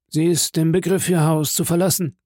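steward. A narrator's reading. Steward reports to the Count on her leaving, high-pitched.

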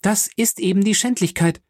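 high-pitched, outraged protest; indignant young Morcerf. Acted. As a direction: protest the disgrace heatedly.

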